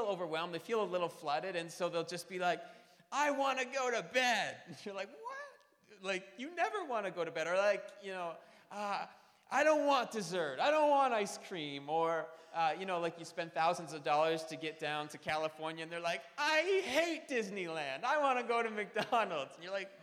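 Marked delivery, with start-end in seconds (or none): 3.1-4.6 put-on whiny kid. Kid voice, irrational request. 5.1-6.0 baffled parent. Retold or imitated. 9.5-11.9 put-on whiny kid. Kid refusing treats. 16.4-19.5 put-on whiny kid. Kid whining at Disneyland.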